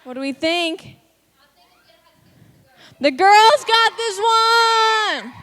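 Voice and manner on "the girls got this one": loud, high, drawn-out shout; slow, ringing contest call; winner announcement. Real, not acted. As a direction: announce the winning team to a crowd.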